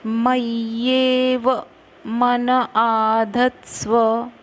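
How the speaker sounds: मय्येव with half य then full य, slow; doubled य in मय्येव. Shown as a demonstration. Teacher showing students the doubled य in मय्येव. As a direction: say मय्येव as may-yeva. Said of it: "मय्येव आधा य, पूरा य".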